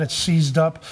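ordinary delivery

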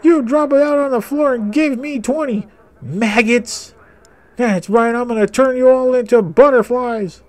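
high, loud mock drill sergeant; drill instructor. Gamer playing drill instructor to maggots, high mock bark. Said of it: "I'm a drill instructor".

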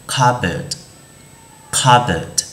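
"cupboard" as CUB-erd, with no P; silent P in cupboard. Shown as a demonstration. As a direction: model "cupboard" carefully, going straight to the B. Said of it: "chúng ta sẽ không đọc cái chữ P đấy lên". (đọc "cupboard" với âm P câm)

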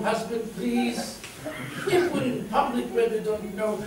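imitating a wife's hushed, patient plea; soft plea. Wife at home asking husband to use her name, soft plea. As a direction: plead softly with the husband.

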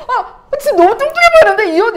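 high, loud mock criticism with laughter in it; hammed-up exclamation at mom's outfit; teasing child. Child to mom about her outfit, high laughing tease.

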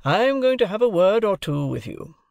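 high-pitched, commanding lawyer's address; cross-examining guardian. A narrator's reading. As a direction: open a stern cross-examination. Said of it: "as if I were a witness in the box".

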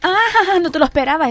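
high, loud ambush cry; triumphant yell. Real, not acted. Player springing her secret tactic on zombies, triumphant yell. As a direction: burst out to surprise the enemy.

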